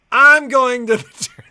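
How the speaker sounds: mock announcement, laughing through it; loud laughing declaration to husband; laughing quote. Wife quoted insisting on the tournament, laughing. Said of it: "And then she's like".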